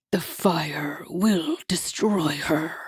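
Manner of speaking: low, slow whispered coo; devil cooing. A narrator's reading. Hasatan's voice.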